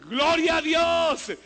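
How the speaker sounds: high, drawn-out cry of glory; exultant praise shout. Real, not acted. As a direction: shout it out in triumph, very loud. Celebra el milagro leído. (grito de alabanza triunfal y prolongado)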